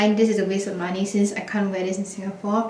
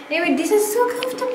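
excited; 11 semitones higher, much faster than usual. Real, not acted. Delighted about comfy new shoes.

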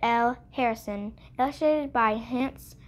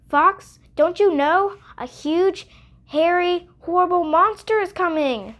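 alarmed rabbit; 6 semitones higher, 4 dB louder than usual. Acted. Rabbit warns Fox.

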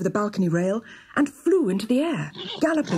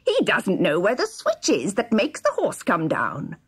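gloating dwarf. A narrator's reading. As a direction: relish every cruel word. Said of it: "sniggered the dwarf".

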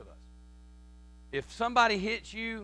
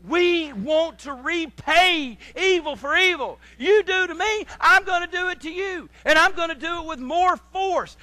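high, loud vengeful declaration; forceful mock retaliation; 8 semitones higher, 6 dB louder than usual. Preacher voicing our urge to repay evil, loud and forceful.

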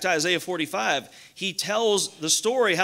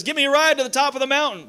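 lightly voiced snake, higher, louder and quick; a hint of a wheedling snake, pitched up; pleading snake; 7 semitones higher, 6 dB louder, faster than usual. Snake asking the climber for a lift, lightly acted plea. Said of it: "The poisonous snake says".

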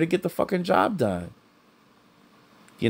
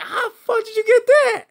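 much higher, incredulous squeal; disbelieving quote. Retold or imitated. Asking the Cuban worker how he got her, high incredulous. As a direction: act out stunned disbelief. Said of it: "I'm looking at this guy like".

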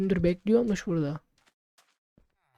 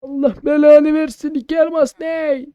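higher, louder exasperated curse; angry curse; 8 semitones higher, 9 dB louder than usual. Real, not acted. Player cursing the shopkeeper over price, loud and higher. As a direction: vent loudly at being ripped off.

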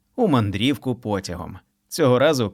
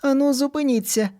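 stern shout. A narrator's reading. Mothers yell at the running children to stop. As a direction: halt the children.

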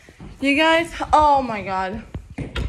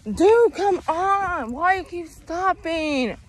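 slow, stretched-out complaint, pitched up; exasperated; 4 semitones higher, slower than usual. Real, not acted. Walker scolding a dog that keeps stopping, exasperated. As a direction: drag the words out in frustration.

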